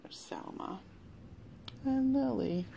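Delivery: hushed pointing aside; whisper. Real, not acted. Reader showing the picture, whispered. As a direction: whisper it to the listener.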